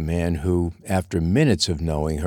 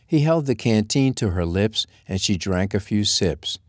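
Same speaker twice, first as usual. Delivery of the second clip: plain reading voice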